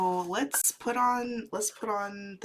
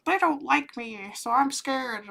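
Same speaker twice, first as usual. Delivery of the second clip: higher, mock-scared whimper of others; 7 semitones higher, 4 dB louder than usual. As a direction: play the nervous stranger, pitched up. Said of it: "people would be like".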